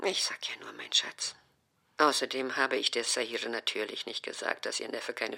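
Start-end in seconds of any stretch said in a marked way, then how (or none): 0.1-1.4 whispered, soft; mother placates her daughter; soft whispered endearment. Acted. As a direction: soothe her sweetly. Mother softens, affectionate.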